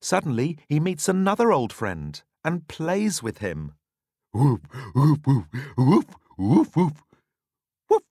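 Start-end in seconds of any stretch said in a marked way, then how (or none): 4.3-8.0 loud bouncy woof woof; playful barking. Acted. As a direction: bark like a happy big dog. Dog plays with friend.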